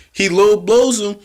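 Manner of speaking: fed-up pitch climbing high; outraged fan. Real, not acted. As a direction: complain like a fan who can't believe it. Fan ranting at the heels.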